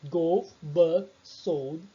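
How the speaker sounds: "Golf bug sold" spelled out very slowly; slow correction. Shown as a demonstration. Teacher corrects her slide, very slowly. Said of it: "era golf".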